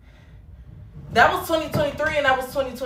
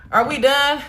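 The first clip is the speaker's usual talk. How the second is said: louder and higher, fed up; impatient call out; 4 semitones higher, 7 dB louder, slower than usual. Real, not acted. Pressing her viewers to finish, loud and impatient. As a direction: demand it, out of patience.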